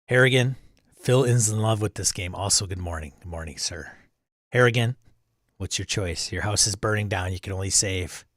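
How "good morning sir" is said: usual speaking voice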